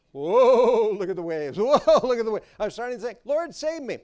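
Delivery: loud, high panicked cries; panicked Peter. Acted. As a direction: yell in mock alarm at the waves. Acted Peter panicking in waves.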